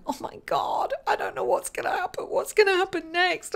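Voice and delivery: high, whispered panic; mock fear. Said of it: "you could be in fear going".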